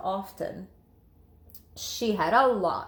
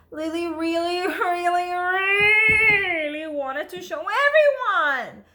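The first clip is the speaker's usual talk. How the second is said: each really higher and louder, building; rising excitement to a loud slow peak; growing eagerness; 8 semitones higher, 6 dB louder than usual. A narrator's reading. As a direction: pile up the longing to burst. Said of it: "building repeated really".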